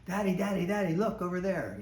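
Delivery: put-on high, eager child calling her dad; excited little girl. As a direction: play the daughter thrilled by the view, pulling at Daddy. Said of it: "she's getting all excited".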